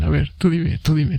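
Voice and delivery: usual speaking voice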